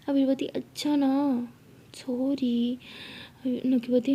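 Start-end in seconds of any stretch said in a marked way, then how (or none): 0.5-2.8 drawn-out, coaxing sorry; tender, wheedling apology; loving apology. Acted. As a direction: stretch it sweetly to win them over. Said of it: "अगर इतना प्यार से बोलोगी".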